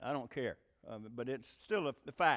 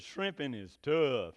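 slow, drawn-out movie-character quote; Forrest Gump; 4 dB louder, slower than usual. Retold or imitated. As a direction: lightly imitate a simple slow talker, stretching "tough". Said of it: "remember in the movie Forrest Gump".

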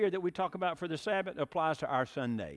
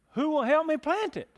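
much higher, louder hen call for help; little red hen asking; 12 semitones higher, 7 dB louder than usual. Acted. Hen asks her neighbors for help, high loud plea. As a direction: sing out the plea to the whole barnyard. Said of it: "she said".

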